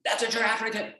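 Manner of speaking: pointing out her drawing proudly; mock proud child; daughter's voice. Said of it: "she goes".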